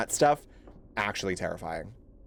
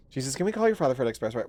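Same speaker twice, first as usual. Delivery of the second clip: fast, tumbling exasperated quip; quick fed-up joke; rushed exasperation; 2 semitones lower, much faster than usual. Real, not acted. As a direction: rattle it off in frustration.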